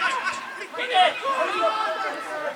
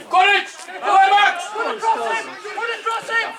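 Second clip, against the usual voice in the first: sideline shouting; 12 semitones higher than usual. Real, not acted. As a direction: bellow encouragement from the touchline. Yelling at players during the match.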